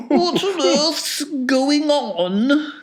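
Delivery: put-on a loud, slow, high old woman's cry; a startled grandmother calling out; cried out. A narrator's reading. Grandma Josephine wakes up and cries out at the noise. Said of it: "cried Grandma Josephine".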